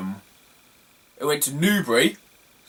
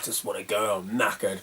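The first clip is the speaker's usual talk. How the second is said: tired grumble; 6 semitones lower, 8 dB quieter than usual. Retold or imitated. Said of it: "You're like".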